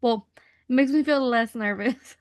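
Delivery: ordinary delivery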